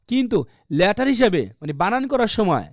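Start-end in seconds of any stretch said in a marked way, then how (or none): none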